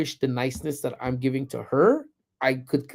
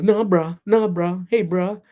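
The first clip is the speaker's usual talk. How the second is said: higher, repetitive mock teen chatter; raised-pitch imitation of girls bantering; mimicking the girls; 6 semitones higher, 3 dB louder than usual. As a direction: fire off slang like mocked teenage girls. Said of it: "But they're like".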